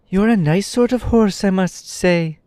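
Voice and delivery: high-pitched Tip voice; high boy exclaiming. A narrator's reading. Tip exclaiming at the fallen horse, high boy voice. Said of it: "he exclaimed".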